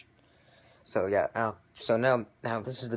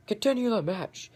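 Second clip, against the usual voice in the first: much higher put-on voice, firm; referee ordering; 12 semitones higher, 4 dB louder than usual. Referee telling the bell guy to keep going, high and firm. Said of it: "she's like".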